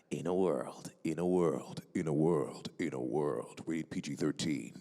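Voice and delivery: low, deep trailer-guy voice; movie trailer announcer. Shown as a demonstration. Voice actor matching the massive trailer voices, deep announcer. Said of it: "when I got into movie trailers".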